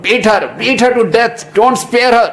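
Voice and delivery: high, loud goading; pitched high, fierce mock command; vengeful urging. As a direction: egg him on to beat her. Imagined vengeful urging to beat.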